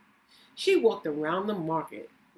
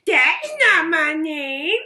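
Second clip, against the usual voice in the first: goblin voice; 10 semitones higher, 11 dB louder than usual. Acted. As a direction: bellow it like a gleeful goblin. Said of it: "smiled the goblin".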